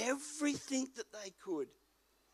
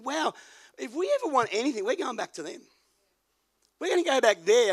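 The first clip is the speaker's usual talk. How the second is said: excited; 5 semitones higher, 9 dB louder than usual. Retold or imitated. Excited retelling in car.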